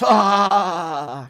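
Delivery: high loud jeering ah; the whole crowd groans in mockery; crowd's mock jeer. Classmates jeering at the girl, high and loud. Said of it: "Everyone goes".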